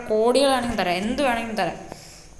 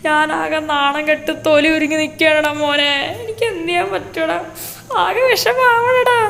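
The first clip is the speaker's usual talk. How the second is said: higher, louder mock wail of a father, stretched out at the end; hammed-up father crying; 5 semitones higher, 7 dB louder than usual. Father at the lockup wailing to his son, hammed-up loud and high. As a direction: play the father wailing over his jailed son, overdone. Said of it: "അവിടെ കിടന്ന് കരഞ്ഞ് ആകെ സീനാക്കി".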